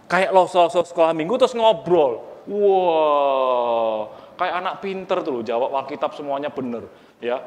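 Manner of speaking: normal talking voice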